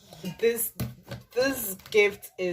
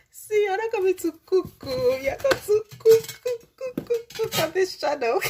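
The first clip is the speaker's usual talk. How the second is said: higher and louder, laughing as she repeats cook; giddy excitement; 12 semitones higher, 6 dB louder than usual. Real, not acted. Thrilled vlogger announces she will cook on the channel. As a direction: chant it with glee, teasing herself. Teasing, thrilled at cookware gift.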